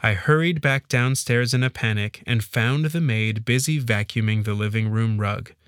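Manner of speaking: usual speaking voice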